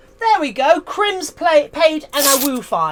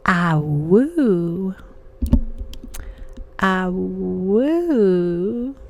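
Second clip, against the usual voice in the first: ASMR wolf howl; 5 semitones lower, much slower than usual. Pretend. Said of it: "A S M R Ah Woos".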